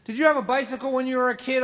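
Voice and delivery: high, fast needling question; father baiting his son, lightly mocking; sarcastic dad. Dad needling his son at the kitchen table, high and fast.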